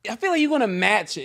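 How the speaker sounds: ordinary talk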